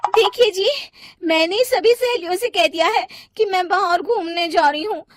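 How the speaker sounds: high-pitched demand, building to loud; insistent wife. Acted. Wife fears friends will laugh, insistent. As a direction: press your husband, ending in a loud high demand.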